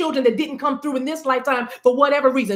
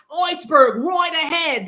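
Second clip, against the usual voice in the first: lookout's warning call; 4 semitones higher than usual. Retold or imitated. Lookout on the Titanic calling out the iceberg warning. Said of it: "I'm hearing iceberg right ahead".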